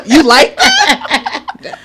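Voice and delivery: slow, sultry come-on; mock seductive. Shown as a demonstration. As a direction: purr it slowly to your man. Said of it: "sexy voice on with my man".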